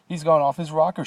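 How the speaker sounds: high, loud frightened shout; aghast shout. A narrator's reading. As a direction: yell it in horror. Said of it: "shouted one of the fathers, aghast".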